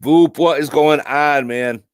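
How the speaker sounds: loud, drawn-out hello to a friend; excited greeting. Real, not acted. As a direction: shout out a buddy's name. Greeting a chatter loudly.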